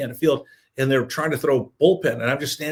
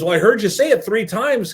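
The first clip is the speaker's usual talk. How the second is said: higher, louder mock accusation; retold insistence; 7 semitones higher, 5 dB louder than usual. Manager who heard the overnight show, insistent. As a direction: push it up and out, pressing the point.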